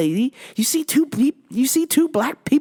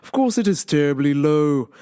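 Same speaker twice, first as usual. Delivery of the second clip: normal talking voice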